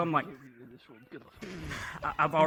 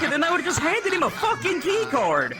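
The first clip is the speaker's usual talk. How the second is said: ordinary talk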